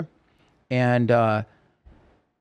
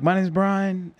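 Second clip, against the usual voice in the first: higher-pitched stage introduction; retold emcee call, pitched up; calling to a crowd; 8 semitones higher, slower than usual. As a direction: introduce yourself to a screaming audience. Introducing himself to screaming crowd.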